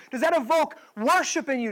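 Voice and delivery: ordinary talk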